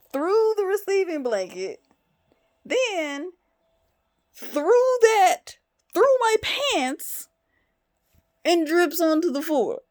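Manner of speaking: very high, loud incredulous exclamation; exasperated, high-pitched amazement; loud disbelief. Real, not acted. Incredulous telling of the diaper blowout.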